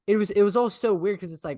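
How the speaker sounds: usual speaking voice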